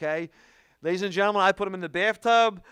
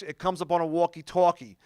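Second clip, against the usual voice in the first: ordinary talk, nothing marked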